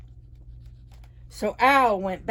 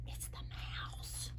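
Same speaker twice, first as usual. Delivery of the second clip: whisper; 20 dB quieter than usual. Real, not acted. Aside to kids.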